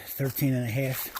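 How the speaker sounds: worn out, barely pushing words; exhausted. Real, not acted. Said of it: "I'm literally done".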